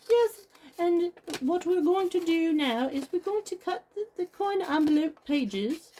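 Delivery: imitated posh accent, high and loud; Kirsten's posh accent. Crafter imitating a fellow video maker's tutorial, high posh accent. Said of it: "do the whole video like Kirsten from Quirky Creams".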